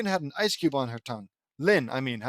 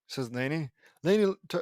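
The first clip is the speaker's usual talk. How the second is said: ordinary delivery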